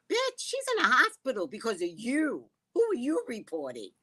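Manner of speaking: high-pitched, incredulous retort; retold indignant comeback. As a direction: replay a sassy clapback, pitch up.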